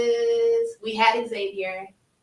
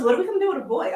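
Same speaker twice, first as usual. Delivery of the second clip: laughing worry; 8 semitones higher, 4 dB louder, much faster than usual. Retold or imitated. Mom's worried question.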